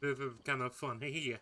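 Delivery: ordinary talk, nothing marked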